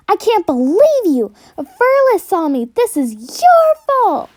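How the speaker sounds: an angry cat spitting at the cloud above; angry hissing cat. Acted. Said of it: "The cat was hissing angrily".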